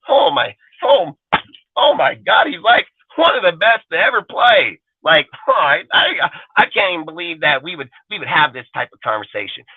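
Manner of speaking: theatrical mock sob; mock sobbing. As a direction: whine like a fan faking tears over a player. Mock crying fan over player.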